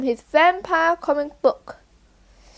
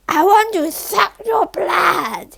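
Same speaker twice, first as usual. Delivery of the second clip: slow, drawn-out vampire shout; hammed-up Dracula shout at the class; 2 semitones higher, slower than usual. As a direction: loom over them and draw out every word. Stranger in vampire disguise.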